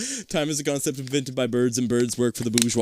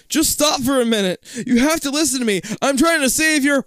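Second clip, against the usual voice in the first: urgent pleading; 11 semitones higher, 4 dB louder than usual. Acted.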